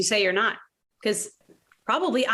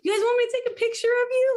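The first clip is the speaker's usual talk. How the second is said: fast, much higher, overly helpful offer; eager old-timer; 14 semitones higher, faster than usual. Retold or imitated. Widow offering the young couple a photo, chirpy and eager. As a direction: chirp it like a hovering older stranger. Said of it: "Like that old person who offers to".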